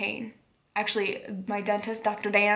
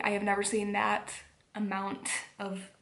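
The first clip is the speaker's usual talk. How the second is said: ordinary delivery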